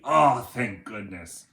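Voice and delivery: ordinary delivery, nothing marked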